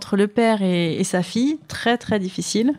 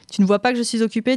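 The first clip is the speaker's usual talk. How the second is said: ordinary delivery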